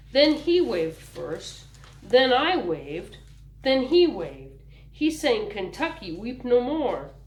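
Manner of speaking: put-on slow, wide-eyed small boy telling his big brother; lightly voiced child, slow and wondering; little boy voice. A narrator's reading. Little Ulysses telling Homer about the train, slow and wondering.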